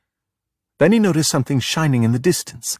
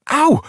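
high, loud cry of pain; boy pinched by a crab, yelping; sharp yelp; 4 dB louder than usual. A narrator's reading. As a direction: yelp as the claw bites.

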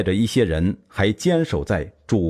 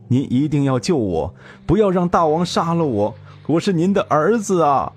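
higher, weeping plea to his father; tearful pleading; 6 semitones higher than usual. Acted. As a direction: beg through tears. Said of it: "赵章只是大哭". (哭着向父亲求救)

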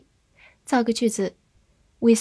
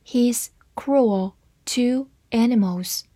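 each word set apart; slow, careful demonstration for learners; slow reading; slower than usual. Shown as a demonstration. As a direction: read it out slowly, word by word. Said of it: "慢慢来读".

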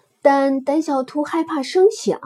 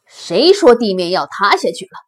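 loud, doubting question to the herd; challenging lion; 3 semitones lower, 6 dB louder than usual. Acted. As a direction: demand to know who said it. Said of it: "狮子说". (狮子大声质疑)

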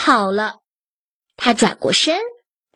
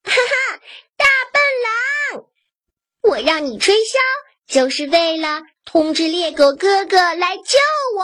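high, triumphant taunt after the fleeing wolf; gleeful call; 6 semitones higher than usual. Acted. Goat shouting after the running wolf, high triumphant teasing. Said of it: "对着狼的背影喊道".

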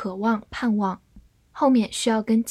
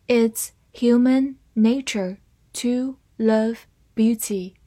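slow reading, words stretched out; 3 dB louder, slower than usual. Shown as a demonstration. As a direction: read it slowly for the class. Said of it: "好，慢慢来读". (慢速朗读)

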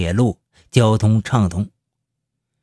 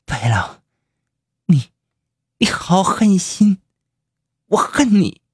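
dying words; 8 semitones higher than usual. Acted. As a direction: gasp out last words. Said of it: "他断断续续地说了句".

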